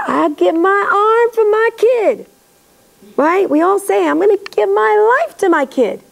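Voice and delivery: put-on a parent boasting they would give their life for their kid; mock grand vow. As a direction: parody a parent's big dramatic promise. Mocking parents' empty boasts.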